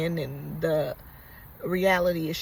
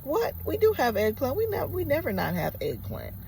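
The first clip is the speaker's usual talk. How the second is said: jumps up high on the first word, stays raised; shocked disbelief; 7 semitones higher than usual. Retold or imitated. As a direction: retell the manager's startled outrage. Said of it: "He got so defensive and so upset".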